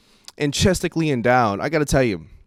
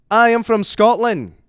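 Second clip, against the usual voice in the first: high, loud, slow mock Scottish accent; 10 semitones higher, 8 dB louder, slower than usual. Man claiming Scottish roots at a bar, mock Scottish accent. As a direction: declare it in an attempted Scottish accent. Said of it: "He said he's from fucking Scotland".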